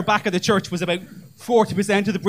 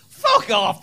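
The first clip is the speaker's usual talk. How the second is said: scoffing retort. Retold or imitated. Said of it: "I went".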